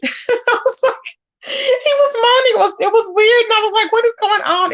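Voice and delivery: high-pitched, laughing through the words; helpless giggly retelling. Real, not acted. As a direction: crack up while telling the story. Cracking up retelling funny story.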